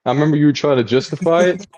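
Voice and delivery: ordinary talk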